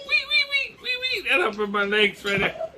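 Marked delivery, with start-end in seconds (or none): 0.1-1.3 very high squeaky chirps; high chirping cricket noise. Retold or imitated. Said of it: "I've been like".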